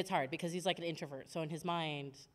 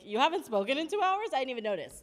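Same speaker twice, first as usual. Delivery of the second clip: incredulous husband; 6 semitones higher, 7 dB louder than usual. Retold or imitated. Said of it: "he's like".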